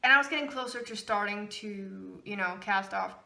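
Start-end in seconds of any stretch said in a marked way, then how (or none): none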